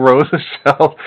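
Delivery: normal talking voice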